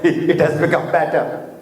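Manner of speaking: ordinary delivery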